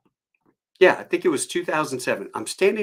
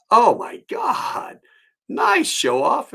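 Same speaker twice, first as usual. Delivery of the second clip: mock surprise; 12 semitones higher, 6 dB louder, much slower than usual. Mock envy at upgrade.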